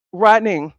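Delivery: ordinary delivery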